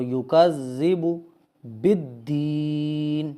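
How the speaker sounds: slow recitation with stretched long vowels; drawn-out recitation. Shown as a demonstration. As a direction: hold the ee in "بالدین". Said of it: "وہاں آپ کھینچ کے پڑھیں گے".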